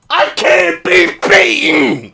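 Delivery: high, loud boastful cry; triumphant shout. Real, not acted. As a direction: crow it victoriously. Gloating over tic-tac-toe.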